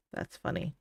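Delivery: ordinary talk, nothing marked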